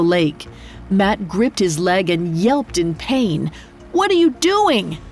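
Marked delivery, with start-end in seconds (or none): 3.9-5.0 alarmed shout. Acted. Panicked passenger over engine.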